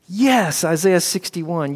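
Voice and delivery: John lighting up as he places the verse; eager recognition. Acted.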